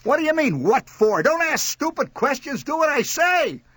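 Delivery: high, angry scolding; exasperated yelling. A narrator's reading. Mother scolds son for questioning her, exasperated yelling. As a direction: yell back in exasperation.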